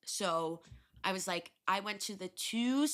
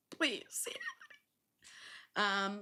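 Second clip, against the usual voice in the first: whispered prayer; 2 semitones higher than usual. Retold or imitated. Drunken prayer to the saint.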